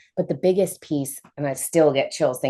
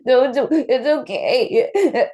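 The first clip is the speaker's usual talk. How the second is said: higher, slow no, no, it's okay; shaky reassurance; 9 semitones higher, 4 dB louder, much slower than usual. Retold or imitated. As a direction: slowly wave off the worry, voice up high. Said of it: "And I was just like".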